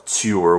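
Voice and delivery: "tour" said like "tyour", a Y slipped in before the vowel. Shown as a demonstration. Showing viewers how she says "tour", with a Y glide. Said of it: "the way I say it is T Y O U R".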